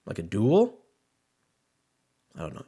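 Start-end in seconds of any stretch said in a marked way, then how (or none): none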